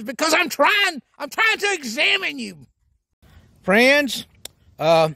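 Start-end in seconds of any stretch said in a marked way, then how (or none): 0.0-2.7 doctor, exasperated. Retold or imitated. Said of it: "He says".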